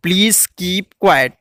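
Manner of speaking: each word drawn out slowly for the class; slow, careful classroom model; slow teaching demo. Shown as a demonstration. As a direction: spell it out slowly for pupils. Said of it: "शांत हो जाओ".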